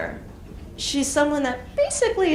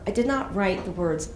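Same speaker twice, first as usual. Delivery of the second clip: ordinary talk, nothing marked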